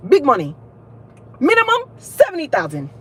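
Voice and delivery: high, punchy African professor parody; exaggerated African accent. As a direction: sell it in a put-on African accent. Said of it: "what kind of accent that was".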